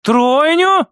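high, rising question; stunned woman checking what she heard; incredulous echo. Acted. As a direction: repeat it upward in disbelief. Mother asks again, incredulous.

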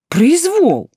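high, loud outraged cry; indignant cry. A narrator's reading. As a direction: cry out against injustice. Heroine protests being led away.

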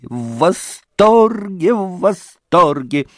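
rapturous мамаша drawing out восторге; delighted. Acted. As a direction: gush over the word восторге. Mother repeats her delight.

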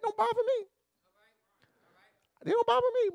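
very high-pitched, breezy dismissal; unbothered. Acted. Preacher saying cussing in frank talk is fine, unbothered. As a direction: shrug it off way up in pitch.

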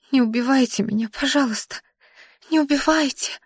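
high, soft pleading; begging for life. A narrator's reading. Exhausted girl begs Kolyvan not to kill her, high and soft. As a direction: plead softly on your knees.

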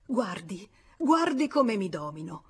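ordinary delivery, nothing marked

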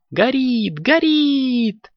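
high, drawn-out happy cries; gleeful high-pitched kid's shout; joyful cheer. A narrator's reading. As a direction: cheer high like a delighted kitten. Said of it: "радостно запрыгал Коржик".

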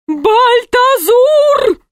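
high, drawn-out yell; yelling a name. A narrator's reading. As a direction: yell the name slowly from another room. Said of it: "раздался из-за двери крик".

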